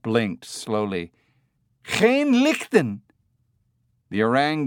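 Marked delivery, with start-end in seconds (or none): 1.8-3.0 slow, high, loud call in Dutch. A narrator's reading. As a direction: call up loudly to an ape. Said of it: "The heavy-set man said loudly".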